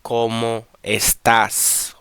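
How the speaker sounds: cómo estás slowly, weight on the final TÁS; emphasis on estás. Shown as a demonstration. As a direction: stretch cómo estás and land firmly on TÁS. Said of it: "we got the emphasis here".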